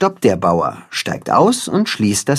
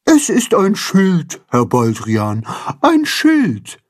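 higher, slow correcting voice, stressing Schild; Frau Feudel; 6 semitones higher, slower than usual. A narrator's reading. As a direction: Schild said slowly and emphatically.

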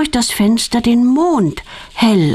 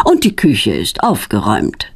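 proud; 10 semitones lower than usual. A narrator's reading. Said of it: "sagt er stolz".

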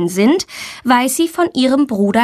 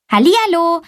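high, cheery hello; joyful greeting; 9 semitones higher, faster than usual. A narrator's reading. New pupil greeting her teacher, joyful. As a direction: greet with open delight. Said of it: "begrüßt Valentina freudig".

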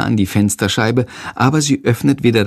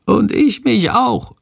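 much higher, slow little creature voice; tiny bat creature; 12 semitones higher, slower than usual. Acted. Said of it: "flüstert leise Shandor".

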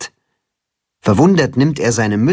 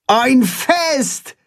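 very high-pitched exclamation; excited call; 13 semitones higher than usual. A narrator's reading. Holstein hears of the party, calling out excitedly. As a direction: cry out in delight, voice far up. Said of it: "ruft Holstein".

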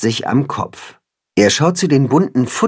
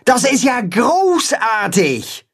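much higher, slow joyful shout; delighted shout; 11 semitones higher, slower than usual. Acted. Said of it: "ruft Holstein".

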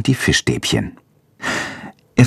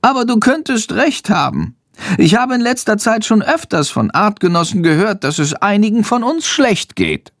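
high-pitched little bat voice; high creature voice, concerned; bat character, subdued after outburst; 10 semitones higher than usual. A narrator's reading.

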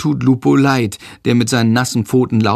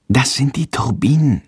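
usual speaking voice

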